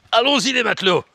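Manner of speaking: loud, high pirate cry to the crew; rally the sailors like a hammed-up captain; pirate captain call. Vlogger playing pirate rallies his viewers, loud high pirate cry.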